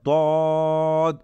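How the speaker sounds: "দদ" with a long, steady rounded vowel; model pronunciation. Shown as a demonstration. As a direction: hold the middle of "দদ" slowly and evenly. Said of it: "কি উচ্চারণ করব?".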